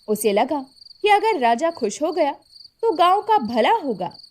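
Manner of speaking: usual speaking voice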